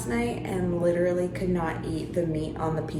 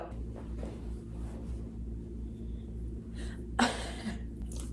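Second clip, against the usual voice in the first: soft laugh; 14 dB quieter than usual. Real, not acted. Laughs after scolding pet.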